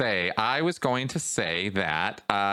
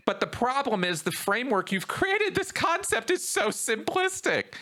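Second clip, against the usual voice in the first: exasperated, climbing much higher in disbelief; rising pitch, incredulous at a silly theory; voice gets high; 6 semitones higher than usual. Retold or imitated. Arguing with conspiracy theorists, exasperated high-pitched disbelief. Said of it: "my voice gets high".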